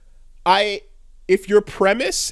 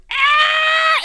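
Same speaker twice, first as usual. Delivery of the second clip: sudden piercing shriek, much higher and louder; mock scream; 4 dB louder than usual. Azula losing it at her brother, piercing mock scream. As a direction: let out a shrill cartoonish scream. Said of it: "And then Azula's like".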